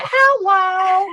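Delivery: very high, loud mimicking holler; piercing parody of the truck's hello; imitated yell back. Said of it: "she would just yell back at it".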